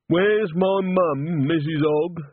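put-on very slow, high, childlike question; slow simple man. A narrator's reading. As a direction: ask slowly like a simple soul. Walter asks, simple man.

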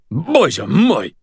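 high startled Russian exclamation; sputtering surprise. Acted. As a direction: blurt it out, shocked. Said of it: "Sonya sputtered".

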